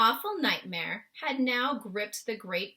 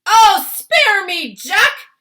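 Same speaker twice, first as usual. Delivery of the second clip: slow, high, very loud plea; yelling; 11 semitones higher, 18 dB louder, slower than usual. A narrator's reading. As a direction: yell out a drawn-out beg for mercy. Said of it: "he yelled".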